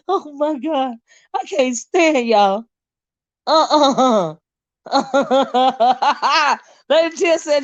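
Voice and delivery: normal talking voice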